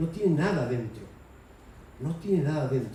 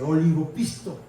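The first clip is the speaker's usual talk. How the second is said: ordinary talk, nothing marked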